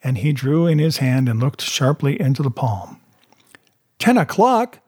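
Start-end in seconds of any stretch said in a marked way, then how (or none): 4.0-4.8 high, startled exclamation; urgent cry. A narrator's reading. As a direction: cry it out in sudden alarm. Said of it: "he cried".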